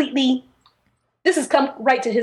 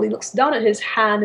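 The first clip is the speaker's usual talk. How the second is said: usual speaking voice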